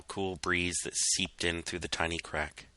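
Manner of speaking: plain reading voice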